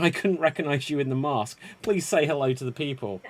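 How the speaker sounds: ordinary delivery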